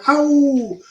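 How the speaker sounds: high, loud mock "Ow!" of dismay; imitated crowd cry. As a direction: mimic the crowd wincing at the crash. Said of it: "You could hear someone going".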